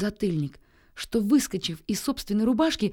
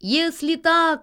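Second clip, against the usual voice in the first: high, loud, drawn-out call; shouting; 8 semitones higher, 5 dB louder, slower than usual. A narrator's reading. Nils shouts at the boasting pigeon, high loud call. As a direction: yell it across the sky. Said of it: "закричал ему Нильс".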